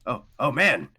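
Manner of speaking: loud, high, amazed talking sword; excited sword. Acted. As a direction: gasp it out, thrilled to feel heat. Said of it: "he goes".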